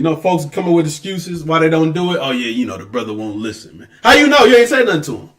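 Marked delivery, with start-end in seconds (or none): 2.2-3.9 much lower and softer, imitating; mock lame excuse. 4.0-5.3 higher, louder retort; sharp challenge. Real, not acted. Preacher challenging the excuse.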